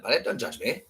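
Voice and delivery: ordinary delivery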